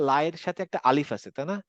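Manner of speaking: ordinary talk, nothing marked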